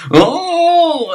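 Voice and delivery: high, loud cry of no; loud shout. Pretend. Loud outburst after quote.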